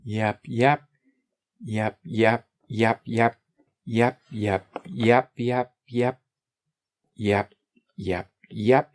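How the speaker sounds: endless bouncy yep, yep; a silly string of yeps; mock yep chatter. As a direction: babble yep like a broken record. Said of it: "I would enjoy talking like that".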